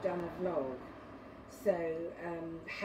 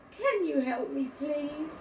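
high, louder begging, acted as a tearful child; tearful plea; 7 semitones higher, 4 dB louder than usual. A narrator's reading. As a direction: plead like an upset little boy. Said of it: "His eyes welled up with tears".

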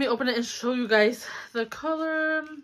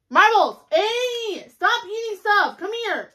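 much higher, loud shout at the dog; calling the dog; 12 semitones higher, 8 dB louder, slower than usual. Real, not acted. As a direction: yell to stop the puppy eating. Dog eating pizza slice.

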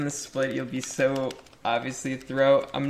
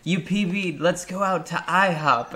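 higher, bright mock mom voice; imitating mom; 6 semitones higher than usual. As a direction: play his mom, cheery and high. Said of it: "My mom's like".

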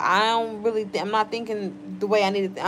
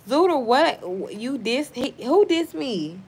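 high, incredulous questioning; indignant disbelief; 4 semitones higher than usual. Real, not acted. Streamer asks commenter who dissed them, incredulous. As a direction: stumble into a raised, offended question.

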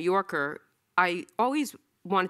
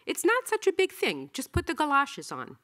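high, coaxing cartoon voice; cartoon voice of reason reading the caption, pitched high; 9 semitones higher than usual. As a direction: nudge them along like an easygoing little inner voice. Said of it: "The voice of reason".

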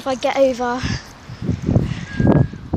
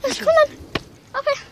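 high coaxing call to dog; 12 semitones higher than usual. Real, not acted.

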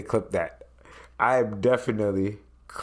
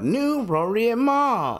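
high emphasis; 13 semitones higher than usual. Real, not acted.

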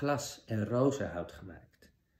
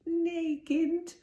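put-on much higher, slow quavering old crone voice; trembling old woman. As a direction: quaver like a frail old woman hiding a wicked queen. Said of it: "zuchtte de oude vrouw".

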